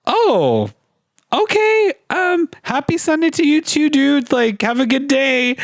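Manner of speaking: very high, chirpy mock-nice reply; sugary politeness. Beachgoer answering the angry dad, sweetly polite. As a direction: kill him with kindness in a high, sweet voice.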